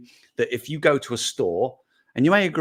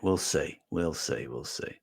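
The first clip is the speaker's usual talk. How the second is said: each we'll see quieter and lower; fading out; 6 semitones lower, 7 dB quieter, slower than usual. Acted. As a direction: let the words trail away to nothing. Repeated, fading away.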